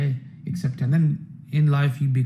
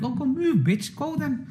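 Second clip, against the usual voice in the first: mocking woman voice; 9 semitones higher, 4 dB quieter, slower than usual. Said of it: "even the women get it".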